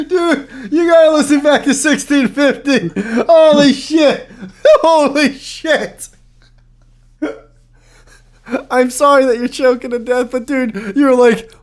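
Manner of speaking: high, loud talking through laughter; laughing. Real, not acted. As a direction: speak while cracking up. Laughing at friend's answer.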